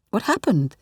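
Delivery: ordinary delivery, nothing marked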